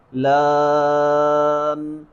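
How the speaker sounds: "لام" very drawn out, pure vowel closing on m; slow sustained model "لام"; correct laam. Shown as a demonstration. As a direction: hold a steady level "لا", then close to m for "لام". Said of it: "پہلے لا، پھر آخر میں میم".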